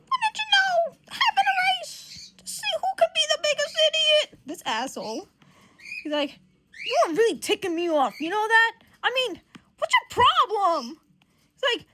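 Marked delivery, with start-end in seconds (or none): 0.1-4.4 very high, loud sarcastic outburst; hammed-up exasperated shout; sarcastic mock yell. Retold yelling at squabbling teammates. 6.9-11.0 mock anger, getting higher and louder; hammed-up confrontation, building to a yell; retold irritation. Retold quarrel, ticked off.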